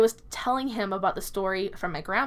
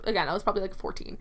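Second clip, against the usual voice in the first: ordinary delivery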